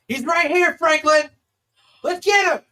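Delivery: high, loud eager yell; excited shout. Acted. Sandy pointing out the demon to Franklin, loud shout. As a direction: shout it like you've found him. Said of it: "points right at you and goes".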